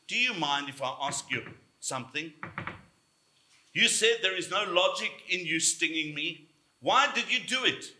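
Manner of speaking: ordinary talk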